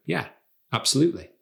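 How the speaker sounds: a single yeah, pause, then absolutely; measured, controlled agreement. Shown as a demonstration. Coach shows confident agreement to a request, measured and controlled. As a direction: agree calmly, leaving space between the words. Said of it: "Instead, you might just wanna go".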